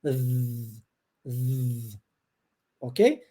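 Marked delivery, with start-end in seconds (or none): 0.1-2.0 long buzzing z with the tongue between the teeth; voiced th buzz. Shown as a demonstration. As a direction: let the tongue vibrate. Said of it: "E aí você vibra ela".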